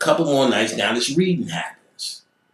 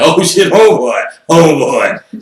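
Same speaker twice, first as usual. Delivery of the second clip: high, loud mock alarm; a hammed-up yelp of surprise; excited oh shit; 5 semitones higher, 9 dB louder, slower than usual. Coworkers spotting him with the book, loud mock alarm.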